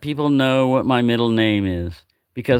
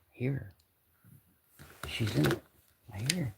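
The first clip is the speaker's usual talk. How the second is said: much softer, hushed so the kitten stays calm; quiet aside; 14 dB quieter than usual. Real, not acted. Spotting the shy kitten nearby, a real hushed aside. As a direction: drop the voice to point her out.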